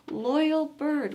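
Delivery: ordinary delivery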